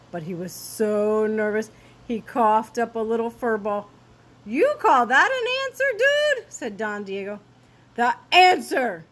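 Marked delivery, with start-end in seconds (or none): 4.5-6.5 much higher, louder scoff; mocking dog voice. A narrator's reading. Don Diego mocks. 8.0-9.0 loud booming demand; bellowed. A narrator's reading. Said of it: "bellowed the Finks".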